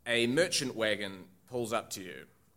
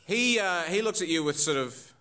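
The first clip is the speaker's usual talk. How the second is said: ordinary talk, nothing marked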